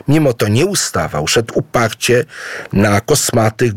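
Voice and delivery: ordinary delivery, nothing marked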